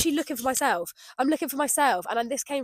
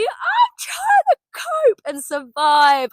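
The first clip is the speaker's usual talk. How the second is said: higher, louder and slow, almost shouting; drawn-out high cry; emphatic cry; 10 semitones higher, 8 dB louder, much slower than usual. Real, not acted. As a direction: plead it out loud. Emphatic cry about coping.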